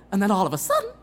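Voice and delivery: ordinary talk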